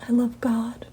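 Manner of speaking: soft whispered devotion. Real, not acted. Declaring her love for God to viewers, whispered. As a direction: breathe it out in a whisper.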